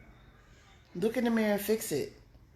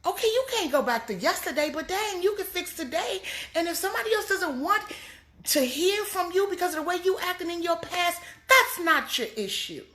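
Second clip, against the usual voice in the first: high, driving sermon cadence; preaching at full force; 11 semitones higher, 3 dB louder than usual. Real, not acted. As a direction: build the congregation up. Sermon climax continues.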